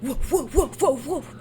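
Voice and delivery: woofing like a dog; dog bark. Pretend. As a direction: bark the woofs out. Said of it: "Is that your bark?".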